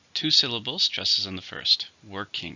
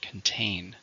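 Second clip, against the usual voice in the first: "Contain" with the weight on TAIN; stress on second syllable; 4 dB quieter than usual. Shown as a demonstration. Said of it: "stress on the second".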